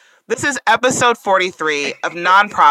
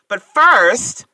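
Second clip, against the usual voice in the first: usual speaking voice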